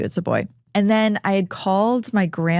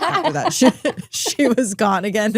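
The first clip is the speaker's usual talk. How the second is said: laughing; 3 semitones higher than usual. Real, not acted. Funny story about grandma's dream.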